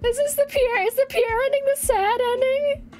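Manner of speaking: high, wailing crying voice; hammed-up sobbing for the character; mock-sobbing. Real, not acted. Crying over sad ending.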